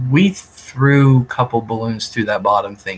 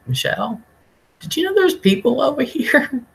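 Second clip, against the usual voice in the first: surprised question. Retold or imitated. Retold dream, startled question to friend.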